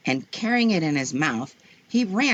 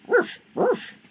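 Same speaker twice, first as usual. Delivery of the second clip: puppy bark. Acted. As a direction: bark like a small eager puppy. Puppy barks.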